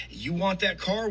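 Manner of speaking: ordinary delivery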